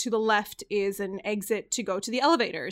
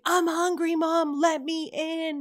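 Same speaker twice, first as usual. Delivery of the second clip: put-on high, slow sing-out of a kid's wail; mock wail without real volume; screaming child, imitated; 6 semitones higher, slower than usual. Hungry child locked out begging mom, imitated high and drawn-out.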